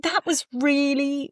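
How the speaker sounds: normal talking voice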